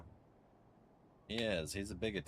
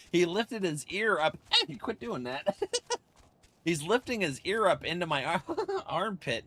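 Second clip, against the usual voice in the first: laughing, louder and higher; being tickled; 10 semitones higher, 9 dB louder than usual. Real, not acted. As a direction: squirm and laugh through it. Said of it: "it's tickling me".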